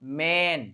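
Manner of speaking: "मैन" with a plain, long ए vowel, softly; "मैन" low-key, vowel held as ए. Shown as a demonstration. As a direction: say "मैन" with an even ए, no wide-mouthed ऐ. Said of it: "ए को कैसे बोलेंगे".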